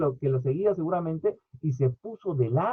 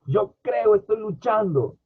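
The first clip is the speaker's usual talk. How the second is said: higher, louder heartfelt plea; father confessing faith, raised and pleading; 5 semitones higher, 4 dB louder, slower than usual. Retold or imitated. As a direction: say it as a father crying for help. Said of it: "quoting father again".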